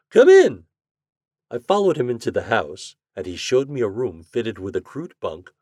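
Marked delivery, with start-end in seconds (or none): 0.1-0.6 called out high and loud; louder call. A narrator's reading. Doctor welcomes visitor.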